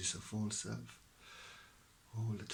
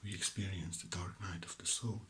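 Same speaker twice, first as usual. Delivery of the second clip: ordinary delivery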